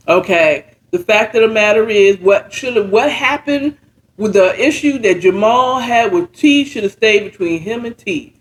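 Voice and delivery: ordinary delivery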